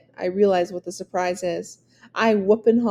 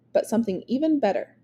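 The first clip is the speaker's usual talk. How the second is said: ordinary delivery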